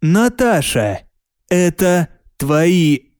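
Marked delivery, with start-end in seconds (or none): none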